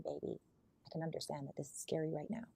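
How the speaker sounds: calm her down quietly; soft reassuring partner. Retold or imitated. Voicing calm leader to partner.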